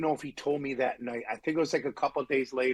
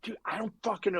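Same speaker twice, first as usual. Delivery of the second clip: high-pitched helpless protest; exasperated, raised-pitch denial; retold helplessness; 7 semitones higher, faster than usual. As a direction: imitate a baffled sound guy pleading ignorance. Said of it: "he's like".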